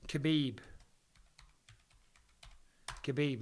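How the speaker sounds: ordinary delivery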